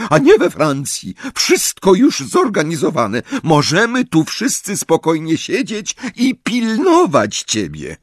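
high, fretful motherly pleading; a worried mother talking sense into the boy; anxious scolding mother. Acted. Mrs Weasley pleads with Harry not to leave, fretful and high.

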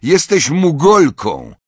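slow, high, loud taunt; contemptuous high-pitched jeer; spiteful insult. A narrator's reading. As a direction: throw the name like an insult, drawn out. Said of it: "wystarczył jej ton, jakim to powiedział".

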